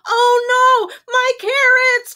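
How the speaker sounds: very high, loud storybook wail; acted anguished cry. A narrator's reading. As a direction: cry out in panic over a lost treasure. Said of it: "cried Rabbit".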